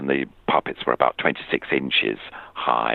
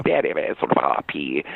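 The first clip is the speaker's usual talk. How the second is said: posh RP voice. Retold or imitated. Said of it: "a most marvellous voice like this".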